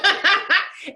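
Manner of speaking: short, bright laugh; laughing at her own remark; quick laugh after a point; high laugh. Real, not acted.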